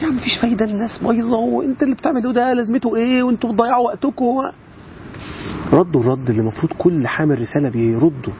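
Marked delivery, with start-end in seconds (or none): 0.0-4.2 raised, mocking voice of the naysayers; higher, weary mock of someone giving up; discouraging people. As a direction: mimic the people who say there's no use. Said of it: "فالناس تقول لك".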